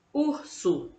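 Urso said high, stressing the opening U; teacher's modelling tone; sounding out a word. Shown as a demonstration. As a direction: lift the U of Urso for the class. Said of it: "olha o som".

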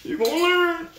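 high mock-crying wail; fake-sob the line. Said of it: "Y'all made me put my crying voice on".

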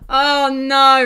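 loud, high drawn-out cry; dismayed. Real, not acted. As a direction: wail it in dismay, stretched out. Fire spreads in game.

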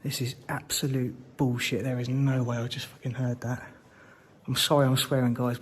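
ordinary delivery

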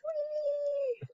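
high, breathy squeal of joy; gleeful whee. Acted. Mouse dancing in the puddle, high gleeful whee.

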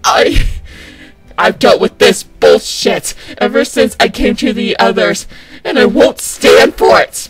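loud, indignant outburst; angry rant. Acted. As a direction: shout down the tormentor, fed up.

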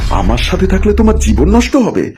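high-pitched, indignant question; hurt disbelief. Acted. Husband answers wife's wish to leave, in indignant disbelief. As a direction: throw it back, wounded and incredulous. Husband stung in acted quarrel.

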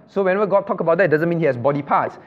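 ordinary talk, nothing marked

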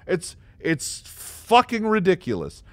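usual speaking voice

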